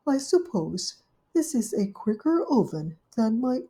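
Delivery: lightly voiced worried thought; Duchess's voice. Duchess privately worrying at the table, lightly voiced. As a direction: muse uneasily to herself.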